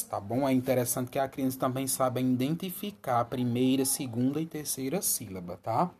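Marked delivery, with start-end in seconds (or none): none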